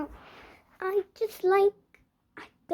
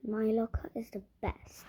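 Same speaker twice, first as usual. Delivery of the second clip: whispered smug aside; hushed little brag; 4 semitones lower, 8 dB quieter than usual. Acted. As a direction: breathe it to yourself, pleased. Whispered boast to herself.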